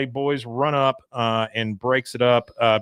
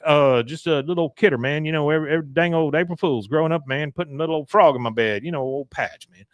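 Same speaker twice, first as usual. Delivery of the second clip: Boomhauer impression; 3 semitones higher, faster than usual. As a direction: mumble it fast like Boomhauer. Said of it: "he's like".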